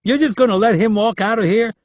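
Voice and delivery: high, loud, disbelieving question; can't believe it; shocked. A narrator's reading. Leland, shocked, questions his superiors loudly. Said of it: "a shocked Leland asked".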